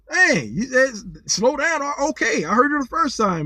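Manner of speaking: ordinary delivery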